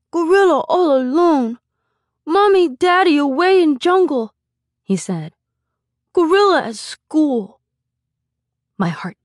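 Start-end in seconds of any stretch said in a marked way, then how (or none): 0.1-4.3 put-on high, loud, plaintive child voice; toddler's lament, pitched way up; sad little boy. A narrator's reading. Small boy explains gorilla's sadness. 6.1-7.6 put-on high, slow child voice; small child stating a sad fact; little boy. A narrator's reading.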